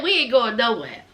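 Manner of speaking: low-pitched, imitated refusal to budge; unbothered refusal. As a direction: drop the voice low, retelling their refusal. Acted refusal by the eating relatives.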